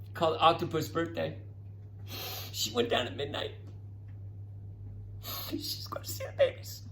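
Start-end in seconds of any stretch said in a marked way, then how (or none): 2.5-6.8 breathless whisper, building suspense; hushed whisper. Retold or imitated. Teacher whispering to kids before the octopus babies hatch. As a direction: whisper it like the big moment is coming.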